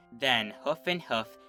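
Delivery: usual speaking voice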